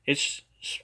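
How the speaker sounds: normal talking voice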